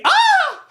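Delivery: sudden very high, loud shriek; hammed-up piercing yelp; mock scream. Comedian cuts off the bully immediately, theatrical high scream.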